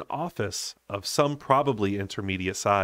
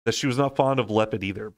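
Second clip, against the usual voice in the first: ordinary delivery, nothing marked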